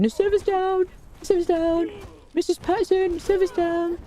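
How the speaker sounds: put-on high whiny teenage boy voice, yelling; Kevin-style whiny teen. Chat spamming the streamer that the server is down, mock whiny teen. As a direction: squawk like a panicked teen. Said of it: "which is where the Carty voice comes from".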